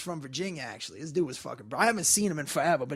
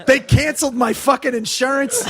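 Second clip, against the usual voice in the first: louder, higher mock-angry punchline; mock anger; 4 semitones higher, 7 dB louder than usual. Retelling an angry comic's car joke, mock angry. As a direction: imitate an angry comic hitting his punchline. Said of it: "he's very angry".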